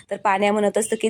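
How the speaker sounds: ordinary delivery, nothing marked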